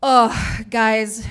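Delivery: ordinary talk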